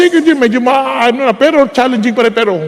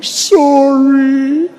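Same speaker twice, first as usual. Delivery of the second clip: sheepish apology; wife turning to apologise; wife's sheepish reply; 2 semitones higher, much slower than usual. Retold or imitated.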